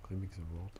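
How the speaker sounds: French speaker's clumsy English accent. Real, not acted. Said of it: "J'ai un accent anglais tout pourri".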